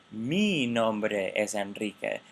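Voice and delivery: "Mi" drawn out with rising-falling focus; slow line, heavy focus on "Mi"; stress on MI. Shown as a demonstration. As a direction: wrongly stretch and stress "Mi". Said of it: "that possessor doesn't like to bear stress".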